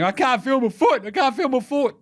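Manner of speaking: high-pitched put-on voice of pain; hammed-up high cry of distress; mock wailing. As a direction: wail it like an injured player. Mimics injured player's wailing.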